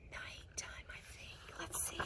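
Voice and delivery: hushed whisper. Acted. Reading to kids at nighttime page, whispering. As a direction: whisper as if night has come.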